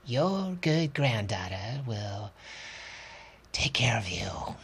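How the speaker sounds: soft, slow, fading to a whisper; soft soothing voice. Acted. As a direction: comfort her tenderly, almost whispering. Doctor comforts Granny.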